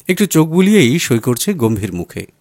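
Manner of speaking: ordinary delivery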